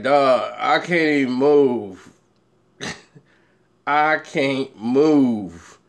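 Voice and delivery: slow, low, drawn-out stoner who can't budge; stoned smoker. Retold or imitated. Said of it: "they be like this after they smoke Bubba Kush".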